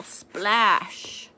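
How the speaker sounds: plain reading voice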